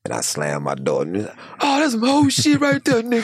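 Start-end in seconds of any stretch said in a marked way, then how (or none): 1.6-3.2 high, loud put-on voice; mock inmate shout. Day room inmate hollering back, high and loud.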